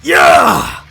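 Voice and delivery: big loud battle yell; triumphant yell. Acted. Player swapping into the match, triumphant yell. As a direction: roar it out as you enter. Acted shout on entering match.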